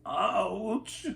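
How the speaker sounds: put-on high sudden ouch from an old man; grandfather's cry. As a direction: yelp it as a hurt grandpa. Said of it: "cried Abuelo".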